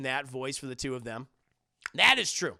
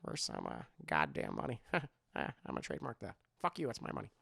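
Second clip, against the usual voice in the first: much lower, soft grumble; George Lucas impression; 12 semitones lower, 9 dB quieter, faster than usual. Streamer doing George Lucas for chat, low grumble. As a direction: grumble greedily about money. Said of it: "my George Lucas impression".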